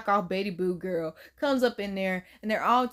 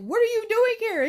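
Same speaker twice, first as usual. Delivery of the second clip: surprised; 12 semitones higher, 3 dB louder than usual. Retold or imitated. Voicing startled creature.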